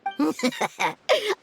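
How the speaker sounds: quick amused laugh; short laugh. Acted. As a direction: laugh lightly at the others. Child laughs at others' scores.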